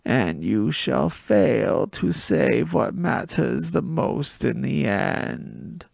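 slow, loud, eerie prophecy rasped out; raspy oracle voice. Acted. Gabe to the boy, raspy slow oracle prophecy. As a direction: intone it like a possessed card player. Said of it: "delivered the worst line of all".

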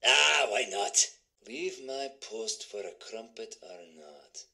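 very soft, low, slow musing; muttering to self. Acted. Guard weighing leaving the post, low slow mutter. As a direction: murmur it low under the breath.

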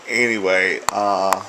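usual speaking voice

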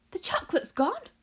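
high, loud alarmed shout. A narrator's reading. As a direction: shout it out in shock. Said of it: "shouted Grandpa Joe".

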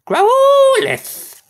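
squeaky critter voice. Pretend. Squeaky voice for Pokémon name.

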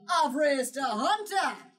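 slow, loud proud papa; proud father. Acted. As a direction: boom it out like a proud dad.

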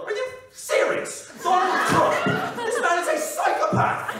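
high, loud, incredulous TV satirist impression; John Oliver. TV host outraged about Donald Trump, high incredulous shouting. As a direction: shout it slowly in high-pitched disbelief. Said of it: "John Oliver".

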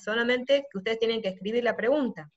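ordinary talk, nothing marked

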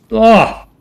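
short sharp high yelp of fright; real jump-scare yelp; startled scream. Real, not acted. Gamer jolted by a sudden enemy, sharp scream.

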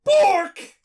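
high, loud yelped bark; dog bark impression. Imitating Dogmeat for the co-host, high loud bark. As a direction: bark the word like an excited dog.

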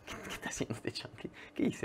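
breathy whisper, barely voiced; whispered inner thought. Watching the stranger run up, whispering to himself. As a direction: whisper it to yourself in disbelief.

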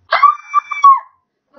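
very high, loud re-enacted shriek; freaked-out scream. Vlogger retelling a shark scare in Minecraft, piercing scream. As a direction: let out a piercing mock scream of panic. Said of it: "totally freaked out".

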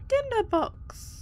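very high, drawn-out squeal of joy; silly celebration. Pretend. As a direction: squeak it out long and high in mock triumph. Celebrating finding a tinderbox.

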